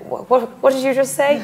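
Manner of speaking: put-on a clumsy, laughing go at an Irish accent; terrible Irish accent. Retelling Maura's reply to Tom in a bad Irish accent. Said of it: "Terrible Irish accent".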